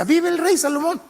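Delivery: high ringing cry of the crowd; cheering shout. Acted. Crowd hailing the new king with a high cheer.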